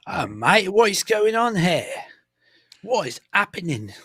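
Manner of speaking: high, loud, drawn-out British "mate" caricature; mock British accent. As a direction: stretch it out in an exaggerated British accent. Joins in mocking accents.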